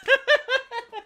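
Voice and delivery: sudden high-pitched laugh; high cackle. Real, not acted. YouTuber cackling about his video. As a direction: cackle at your own joke.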